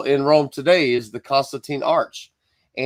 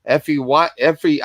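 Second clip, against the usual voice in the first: usual speaking voice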